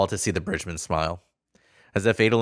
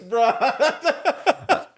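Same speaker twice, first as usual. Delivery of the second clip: exasperated; 14 semitones higher than usual. Real, not acted. High exasperated reply.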